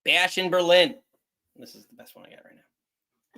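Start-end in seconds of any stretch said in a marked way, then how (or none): 1.6-3.4 hushed confiding whisper. Real, not acted. Host admitting his thumbnail is weak, whispered. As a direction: breathe it out like a secret.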